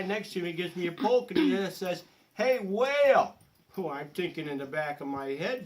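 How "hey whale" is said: loud, slow shout of hey whale; calling out. Retold or imitated. Deck neighbour calling out loud, pointing at sea. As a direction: bellow it across the deck.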